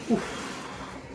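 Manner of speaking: ordinary talk, nothing marked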